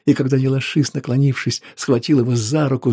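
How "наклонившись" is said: ordinary delivery, nothing marked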